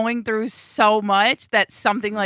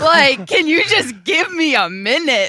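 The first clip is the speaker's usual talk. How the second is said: put-on exasperated teen; 2 semitones higher, slower than usual. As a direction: act out a fed-up teenage daughter. Voices annoyed teenage girl to dad.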